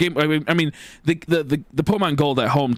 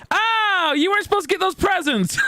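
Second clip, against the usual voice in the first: very high mock mom voice; high-pitched imitation of a mother's dismay; flustered mom. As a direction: squeak it as an alarmed mother. Acts mom caught out.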